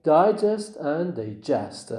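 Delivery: first digest stressed up front, second digest at the end; contrasting syllable stress. Shown as a demonstration. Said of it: "the stress is on the first syllable".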